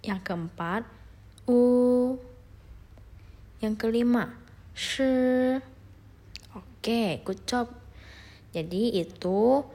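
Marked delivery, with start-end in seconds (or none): 1.5-2.1 "u" held long, high and loud; pronunciation demo. Shown as a demonstration. Teacher asks students to follow along, sustained demo. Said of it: "cara pengucapannya". 4.8-5.6 "shi" held long, high and level; pronunciation demo. Shown as a demonstration. Teacher asks students to follow along, drawn-out demo. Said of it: "cara pengucapannya".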